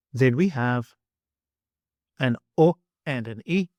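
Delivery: ordinary delivery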